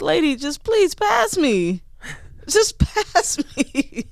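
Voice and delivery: high, slow begging with a laugh; pleading. Retold or imitated. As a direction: drag it out, begging the teacher. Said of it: "It's like".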